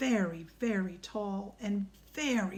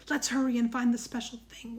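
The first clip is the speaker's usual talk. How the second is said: ordinary delivery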